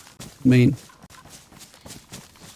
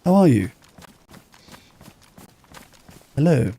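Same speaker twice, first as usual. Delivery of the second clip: friendly voice; 2 semitones higher than usual. Shown as a demonstration. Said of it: "That's my friendly voice".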